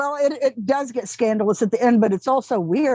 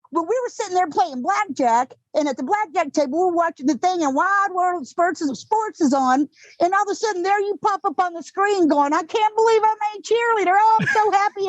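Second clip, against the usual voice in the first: high-pitched Texas mom retelling excitedly; drawn-up, gushing mother voice with a Texas twang; Texas accent; 9 semitones higher than usual. Retold or imitated. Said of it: "you know a Texas accent well".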